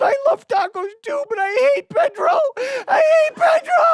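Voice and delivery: very high, loud sobbing shout; crying tantrum. Retold or imitated. Brother crying to the family over tacos, shouting. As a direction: wail it like a heartbroken kid. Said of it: "Robert just cries".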